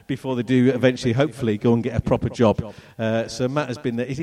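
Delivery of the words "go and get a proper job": ordinary talk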